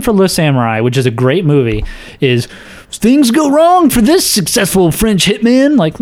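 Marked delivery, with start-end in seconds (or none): 2.9-5.8 mocking read. Reading a bad tagline mockingly.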